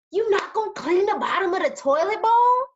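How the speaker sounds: harsh accusation. Retold or imitated. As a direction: imitate a partner coming at you harshly. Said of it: "if you coming at me talking about".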